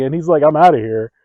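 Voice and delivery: loud, high, exasperated retelling; indignant shouting. Retold or imitated. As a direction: yell it in disbelief at the betrayal. Still ranting about friend's escape.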